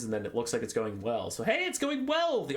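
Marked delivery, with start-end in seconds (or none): 1.4-2.4 high, bright burst of cheer; sudden high-pitched delight; excited cheer. Acted. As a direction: sell the good news like a cheerleader. Cheering on the battle turn.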